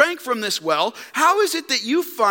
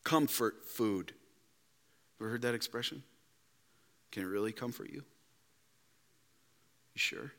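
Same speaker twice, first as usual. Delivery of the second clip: quietly pin them down; soft probing; 7 semitones lower, 14 dB quieter than usual. Real, not acted. Preacher softly questioning comfort food, hushed.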